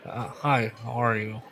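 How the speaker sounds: lightly pinched-nose test voice. Shown as a demonstration. Trying out a voice on the podcast, nose pinched. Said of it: "Let me pinch my nose".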